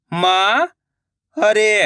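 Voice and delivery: मारे drawn out slowly, syllable by syllable; slow syllable split. Shown as a demonstration. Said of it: "मैं किस तरीके से".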